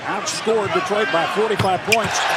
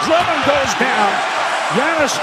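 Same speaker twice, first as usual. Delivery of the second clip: excited call; 5 semitones higher than usual. Real, not acted. Live call of a big play.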